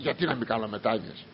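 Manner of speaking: imitating a conceited objector, low and soft; egotist's put-on air. Preacher mimics so-called devout people objecting, low, self-important air. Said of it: "έτσι παίρνουν ένα τέτοιο ύφος".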